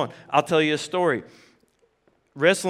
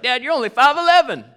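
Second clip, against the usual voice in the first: put-on teasing kid; 8 semitones higher, 7 dB louder than usual. Son teasing dad about height.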